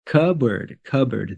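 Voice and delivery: "cupboard" said CUB-erd; teacher's model "cupboard", only a B in the middle; silent P. Shown as a demonstration. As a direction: drop the P from "cupboard". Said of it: "The P is silent in this word".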